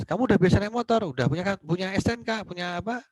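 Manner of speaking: high-pitched, lightly cross grilling; mock angry questioning. Lecturer as Amanda grilling the rider, mock angry. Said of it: "marah sama kamu".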